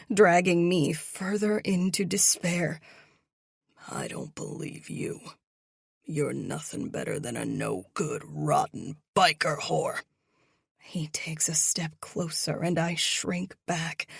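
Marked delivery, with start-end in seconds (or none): 3.9-10.0 much lower, contemptuous growl; sneering low threat; menacing deep villain. Acted.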